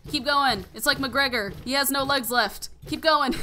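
cheering on a beaten enemy, excited. Real, not acted. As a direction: urge it on, half laughing at the joke. Cheering hits in game.

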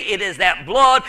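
ordinary talk

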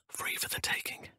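a sly hushed whisper; stealthy whisper. Acted. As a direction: breathe it while sneaking. Sneaking, eyeing loot.

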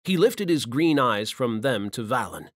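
ordinary delivery

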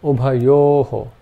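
ubhayoho said slowly, final ho breathed out; slow visarga demo. Shown as a demonstration. Teacher slowly demonstrating visarga, ubhayoho breathed out. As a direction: draw out ubhayoho, puff the last ho. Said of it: "Some examples to illustrate this are".

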